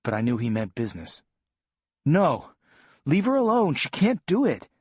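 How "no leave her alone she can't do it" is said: much higher, louder, desperate shout; defiant yell. Retold or imitated. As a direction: scream it at him, refusing to back down. Boy defies dad, yelling.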